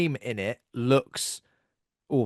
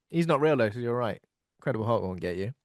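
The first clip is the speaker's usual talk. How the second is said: normal talking voice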